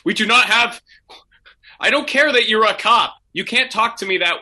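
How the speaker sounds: high, loud outraged protest; indignant prank-call victim. Acted. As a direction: play a flustered caller getting worked up. Acting outraged prank call recipients.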